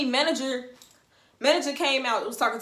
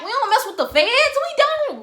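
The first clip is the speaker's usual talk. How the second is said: emphatic protest; 13 semitones higher, 8 dB louder than usual. Retold or imitated. Teller protesting a police call.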